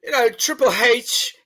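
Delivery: high, drawn-out English accent impression; Billy Body. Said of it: "you can do Billy Body better".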